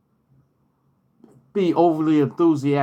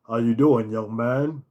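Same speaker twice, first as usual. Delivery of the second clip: low, deep booming greeting; lightly voiced famous deep voice; a hint of James Earl Jones; James Earl Jones impression; 4 semitones lower than usual.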